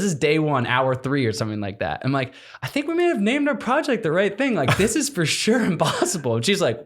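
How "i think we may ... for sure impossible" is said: high, half-laughing irony; rueful laughing quote. Retold or imitated. Telling his wife on the sat phone, high and rueful. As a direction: laugh it off at your own doom.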